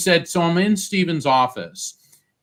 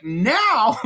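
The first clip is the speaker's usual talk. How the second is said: astonished; 9 semitones higher, 3 dB louder than usual. Retold or imitated. Told the interview starts now.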